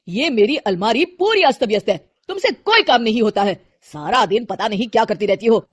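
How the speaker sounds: husband snapping at his wife; lightly voiced cross husband; annoyed husband. Husband scolds about cupboard.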